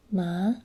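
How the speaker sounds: ma voiced aloud, dipping then rising; question tone. Shown as a demonstration. Tutor voicing ma with dipping, rising question tone. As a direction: say ma aloud, drop then lift the pitch. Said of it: "you sort of descend and then ascend again".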